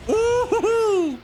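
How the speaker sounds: high, slow delighted hoot; gleeful high hoot at a huge hit; excited hoot. Real, not acted. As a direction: let out a thrilled falsetto ooh-hoo-hoo. Big crit hit in game.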